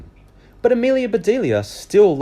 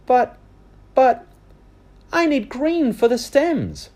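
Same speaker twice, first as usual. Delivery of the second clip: put-on much higher pleading child; protesting girl; 5 dB louder than usual. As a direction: plead to keep working. Amelia protests.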